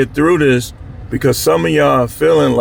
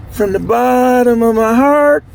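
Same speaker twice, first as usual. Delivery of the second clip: mock sincerity; 9 semitones higher than usual. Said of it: "I say it".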